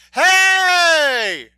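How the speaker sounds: very high, loud, drawn-out holler; long shouted hey. Acted. Hunter yells at the deer before firing, long shout. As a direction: holler and stretch it out to startle.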